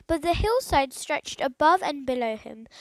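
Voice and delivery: usual speaking voice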